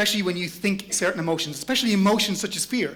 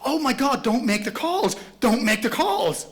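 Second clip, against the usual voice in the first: higher-pitched mock panic, drawn-out ending; panicky inner voice; 6 semitones higher, slower than usual. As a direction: plead in pretend alarm not to do it. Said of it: "suddenly you're hearing this voice".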